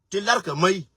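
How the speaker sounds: normal talking voice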